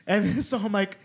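usual speaking voice

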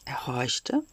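hushed and breathless; barely voiced, tense hush; whisper. A narrator's reading. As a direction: whisper it as if listening hard. Listening in the quiet.

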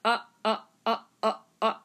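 staccato uh. Shown as a demonstration. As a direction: punch out quick clipped uhs. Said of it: "kind of like staccato".

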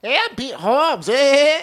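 high, slow, exaggerated Pete Holmes voice; hammed-up celebrity voice; Pete Holmes impression. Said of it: "That's pretty good".

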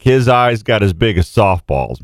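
normal talking voice

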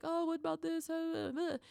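put-on high, pleading child's voice; a kid asking mom for help, mildly mocked; whiny kid voice. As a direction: mimic a needy kid tugging at mom. Kids interrupting, voiced by mom.

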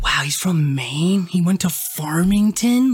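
whispered, slow disbelief. Retold or imitated. Snooping on the kid's profile, whispering in shock. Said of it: "I'm like".

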